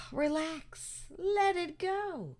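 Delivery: soft sigh into quiet words; soothing release. Real, not acted. Speaker urging friends to relax, soothing. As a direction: ease off softly, letting the breath go.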